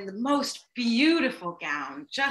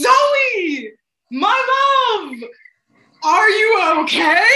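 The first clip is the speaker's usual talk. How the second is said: high, loud, stretched theatrical cry of concern; melodramatic lover; 9 semitones higher, 9 dB louder, much slower than usual. Retold or imitated. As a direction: stay in character while trying not to laugh. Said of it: "And he goes".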